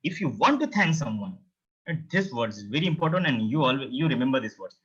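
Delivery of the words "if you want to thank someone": ordinary delivery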